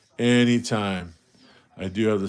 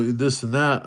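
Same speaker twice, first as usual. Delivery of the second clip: normal talking voice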